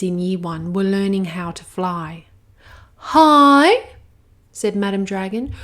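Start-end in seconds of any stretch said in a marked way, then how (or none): 3.0-3.9 high and loud, calling down from the sky. Acted. Madam Dragon speaking.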